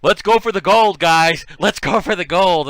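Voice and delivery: high, loud rallying cheer; hyped cheer. Retold or imitated. Eddie hyping the guys up, loud and high. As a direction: pump the whole room up. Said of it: "Eddie Spaghetti's going".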